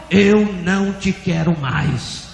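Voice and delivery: slow, stretched-out firm declaration; voice of God. Retold or imitated. God answering the pastor's plea, slow firm refusal. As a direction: draw every word out with force.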